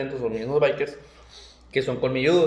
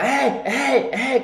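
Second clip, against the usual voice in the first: retold yell; 7 semitones higher, much slower than usual.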